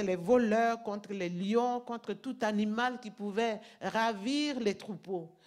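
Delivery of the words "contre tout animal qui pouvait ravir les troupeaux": normal talking voice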